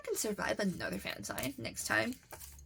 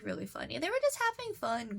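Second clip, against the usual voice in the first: usual speaking voice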